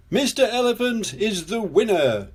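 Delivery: high, ringing winner announcement; announcing. A narrator's reading. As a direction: proclaim it like a contest host. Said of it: "announced Mister Potato".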